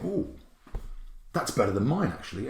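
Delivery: soft, admiring ooh that fades away; quietly impressed, almost to yourself; soft impressed aside. Retold or imitated. Teacher admiring a child's trick, softly impressed.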